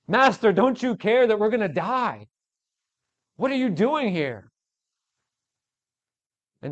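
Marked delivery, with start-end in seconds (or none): 0.1-4.5 high, pleading cry; desperate plea. Acted. Disciples pleading with Jesus, high and desperate. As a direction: beg him as the boat fills. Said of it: "And so".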